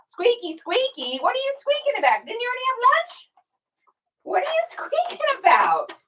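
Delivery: very high, fast teasing pet voice. Real, not acted. Teasing her dog about his squeaky toy, high fast baby talk. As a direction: tease the pup playfully.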